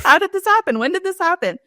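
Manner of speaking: high-pitched, bewildered questions; mock shock. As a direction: imitate someone clueless and suddenly stunned. Moss stunned by news of death.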